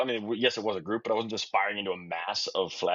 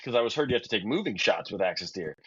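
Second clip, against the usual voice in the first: ordinary talk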